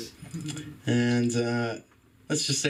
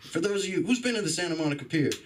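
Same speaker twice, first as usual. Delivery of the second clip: ordinary talk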